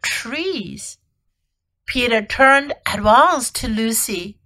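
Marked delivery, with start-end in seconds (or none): none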